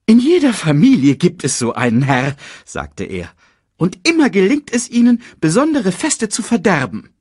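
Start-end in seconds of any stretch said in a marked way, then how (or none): 0.1-2.4 high, loud, officious chatter; placating herald. A narrator's reading. Sasu tries to placate his king, high placating voice. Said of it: "versuchte, seinen Herrn zu besänftigen". 3.8-7.1 very high, fussy remark; placating herald. A narrator's reading. Sasu tries to calm the king about Scar, high fussy placating. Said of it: "versuchte, seinen Herrn zu besänftigen".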